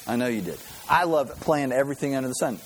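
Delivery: usual speaking voice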